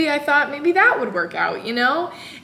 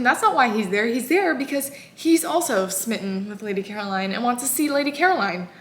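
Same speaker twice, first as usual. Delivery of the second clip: ordinary talk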